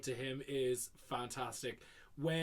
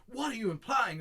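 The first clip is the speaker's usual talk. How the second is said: higher, indignant mock outburst; mock outrage; 6 semitones higher, 3 dB louder, faster than usual. Fan quoting Brad losing it, mock indignant. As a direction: act affronted, lightly overdone. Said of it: "Brad sort of loses his shit".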